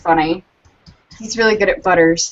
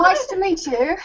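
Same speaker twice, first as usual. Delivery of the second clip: a higher, put-on English voice for a greeting; tentative British accent on the spot; mock British accent; 9 semitones higher than usual. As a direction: try out a posh English hello, shyly. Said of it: "Go on, give it a go".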